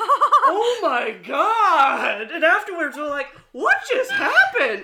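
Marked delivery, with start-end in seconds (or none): 0.0-2.3 long, loud, drawn-out exclamation; retold overwhelmed gasp of amazement; amazed outburst. Acted reaction to surprise sex. 3.5-4.8 high, loud, laughing disbelief; retold shocked outburst on the edge of laughter; stunned disbelief. Retelling their stunned reaction afterwards.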